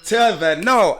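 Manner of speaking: loud, stretched hell to the no; sassy outrage. Retold or imitated. Seeing them all over her Mustang, loud sassy outrage. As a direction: sass it out loud and slow. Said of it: "I was like".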